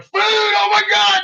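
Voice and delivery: high, loud excited yelp of a dog. Retold or imitated. Owner voicing the excited dog at dinner, high loud yelp. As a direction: shout it like a dog seeing food.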